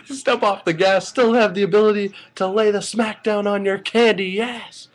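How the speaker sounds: excited fan reciting the Rock's jabroni catchphrase; The Rock promo. Retold or imitated. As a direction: rattle off the insults like a wrestling promo. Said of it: "he also did his".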